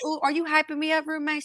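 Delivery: high, quick teasing question; playfully flattered. Acted. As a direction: act tickled at being hyped up. Flattered reply to inner roommate.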